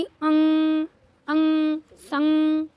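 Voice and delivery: अंग, अंग, संग, each nasal hummed long and loud; held nasal sound. Shown as a demonstration. Teacher modelling the dot's sound for children, held nasal. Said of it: "इस तरीके के से इसका उच्चारण".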